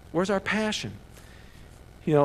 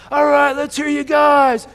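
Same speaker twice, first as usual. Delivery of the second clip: high, very loud, drawn-out crowd rouser; slow, full-voiced hype shout; pep rally shout; 10 semitones higher, 12 dB louder, slower than usual. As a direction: holler to get a whole gym cheering. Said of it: "You go to a high school pep rally".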